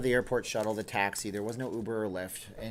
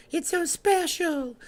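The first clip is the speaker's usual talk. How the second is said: [name]; 5 dB louder, much slower than usual. Retold or imitated. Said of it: "That's Michael".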